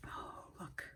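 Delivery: breathless whisper of wonder. Real, not acted. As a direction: hush it like a secret treasure. Hushed delight at the gift.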